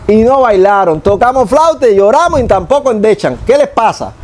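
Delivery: mock young man hailing his friend; youth calling to a friend. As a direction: shout across the plaza to a buddy. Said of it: "y le dice a su socio".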